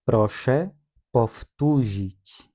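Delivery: Proszę, pause, then powtórzyć drawn out slowly; teacher spacing Proszę and powtórzyć apart, powtórzyć stretched slowly; slow, split in two. Shown as a demonstration. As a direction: give each word its own slow beat for the class to copy. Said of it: "Repitam, por favor".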